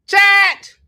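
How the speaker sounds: high, loud call to the chat. Real, not acted. Preacher calling out to his live chat, loud. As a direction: shout it out to get chat's attention.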